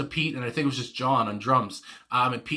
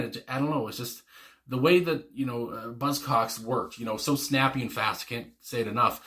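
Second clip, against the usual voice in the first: ordinary talk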